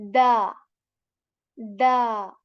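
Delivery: "da" short and light. Shown as a demonstration. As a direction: tap "da" lightly. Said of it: "you can say".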